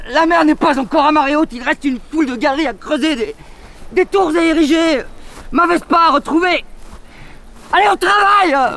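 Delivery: high, loud rallying cry; rousing rally. Acted. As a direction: spur him back to work. Sancho rallies his master.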